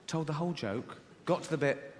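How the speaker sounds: ordinary delivery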